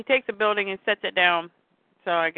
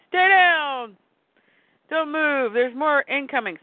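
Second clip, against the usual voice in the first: raised, higher-pitched warning, loudest at the start; urgent command; 7 semitones higher, slower than usual. Acted. Superman shielding kid and dog, urgent warning shout. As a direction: call out an urgent order under fire. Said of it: "and he goes".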